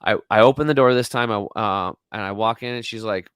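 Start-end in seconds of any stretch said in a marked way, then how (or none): none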